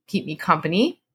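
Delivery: plain reading voice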